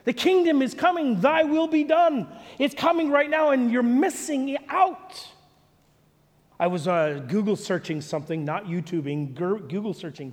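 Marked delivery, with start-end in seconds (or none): 0.1-5.3 raised, high-pitched preaching plea; urgent exhortation. Acted. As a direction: proclaim it urgently to the crowd. Preacher voices Jesus urging the crowd.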